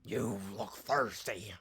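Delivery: slow, low creepy creature line; low villain voice. Acted. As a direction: drag it out like a sinister creature. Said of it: "talking like a D C villain".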